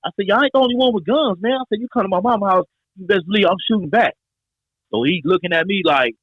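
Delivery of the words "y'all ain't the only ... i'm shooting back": higher, defiant threat; retold talking crazy. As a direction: throw the threat right back at him. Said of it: "So I started talking crazy to him back".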